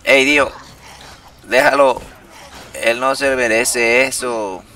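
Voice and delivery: loud high shout; loud mock heroic shout; shouting at enemy. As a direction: yell it to stop him. Yelling at enemy in game.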